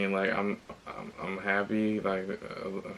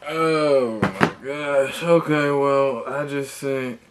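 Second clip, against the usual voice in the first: salty outburst; 4 semitones higher, 8 dB louder than usual. Real, not acted. Customer who just emailed the seller, salty and loud. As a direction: vent loudly, really exasperated. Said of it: "I'm hella salty about that".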